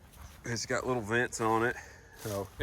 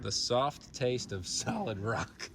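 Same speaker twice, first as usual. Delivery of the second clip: ad slogan voice; 6 dB quieter, slower than usual. Pretend. Mother echoes ad slogan.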